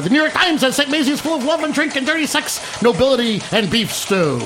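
high, rapid newsreel announcer; Walter Winchell voice. Pretend. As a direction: rattle off reviews like a 1940s gossip newscaster. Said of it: "I wanna do my Walter Winchell voice".

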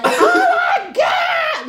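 very high, slow laughing scream; excited scream. Retold or imitated. Bachelorette party catching his shirt, screaming high. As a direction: shriek like a thrilled fan. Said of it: "they're like".

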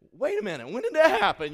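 mother catching on mid-sentence; shocked disbelief. Retold or imitated. Mom learning old misdeeds.

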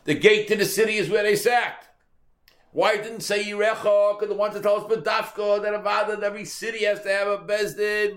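high, loud, emphatic preaching voice. Acted. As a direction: drive the lesson home like a sermon. Preaching the lesson.

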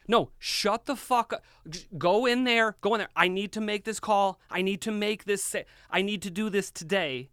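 retold panicked rant at wife; panicked snapping. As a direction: bark it out, frantic and cursing. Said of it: "I'm freaking out".

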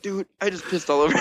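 lightly voiced apologetic drunk buddy; sheepish drunk friend. Drunk friend confessing, retold.

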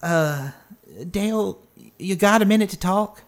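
lightly voiced shy request; timid Sarah. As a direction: ask tentatively, feeling your way in. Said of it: "kinda timidly peeks her head in".

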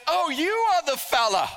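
put-on high, lilting mother-in-law in a PEI accent. Mother-in-law meeting her daughter's new fella, imitated PEI accent. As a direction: greet them in a hammed-up PEI accent. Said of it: "That's my best P I accent".

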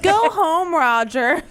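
mocking echo. Player echoes the brush-off.

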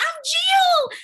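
loud, high-pitched introduction; calling out. Retold or imitated. Jill announcing herself from across the street, calling out. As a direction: call it brightly over the traffic.

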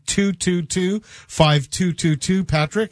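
slow, spaced-out digits; said slowly. Shown as a demonstration. Host gives out the call-in number, slow and spaced out. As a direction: read each number out deliberately slowly for callers. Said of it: "and I'll say it slowly".

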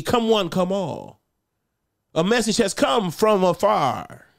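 loud, high proclamation, slow at end; town crier. Retold or imitated. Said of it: "He was called the town crier".

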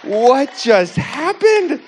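high, loud, drawn-out cry of disbelief; freaked out. Retold or imitated. Man retelling an instant healing, stunned outcry. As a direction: act out stunned shock, stretching every word. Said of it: "I'm, like, so freaked out".

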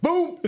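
high-pitched punchy "boom"; imitated punch sound. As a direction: voice the blow landing as a sound effect. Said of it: "fucking dude was like".

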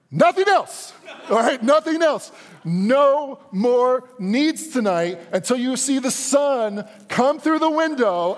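high, loud, hammered-out ultimatum; slow right down and drive each demand home at high pitch; exasperated dad outburst. Retold or imitated. Dad at his limit scolding his son, high exasperated yelling. Fed-up dad lays down law.

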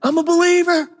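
very high, laid-back bro voice; high, hammed-up bro voice; mock chill bro. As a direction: play the too-cool believer. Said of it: "give 'em to the person".

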